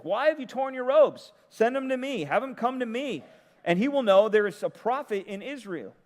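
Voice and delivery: high, loud, commanding call; bold prophet's summons. Retold or imitated. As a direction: proclaim it like the prophet, calling him over. Elisha's message voiced by preacher.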